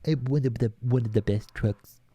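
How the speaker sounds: half-baked presidential impression; Donald Trump. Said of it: "I sound like Donald Trump".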